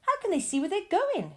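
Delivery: high, loud frightened question; a girl's high scared cry; shriek. A narrator's reading. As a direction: shriek it into the dark. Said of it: "shrieked Violet Beauregarde".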